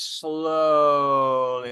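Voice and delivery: "slowly" drawn right out on one long note; stretched SLOWLY. Shown as a demonstration. Showing a hose turned off, "slowly" stretched long. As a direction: drag out "slowly" like water petering out. Imitates hose slowing to trickle.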